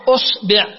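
أصبع said usbi', u then i; short u, then short i in أصبع; damma, then i. Shown as a demonstration. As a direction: demonstrate أصبع as US-BI'. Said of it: "ثم تأتي إلى الضمة فتقول".

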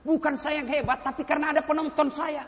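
ordinary talk

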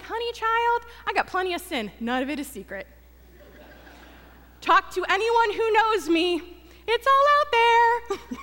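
playful sass rising to a loud, high finish; sassy retort. Retold or imitated. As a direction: act out an indignant confession with relish. Comic sassy comeback to retold accusation.

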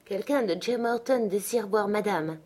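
ordinary delivery, nothing marked

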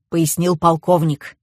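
ordinary delivery

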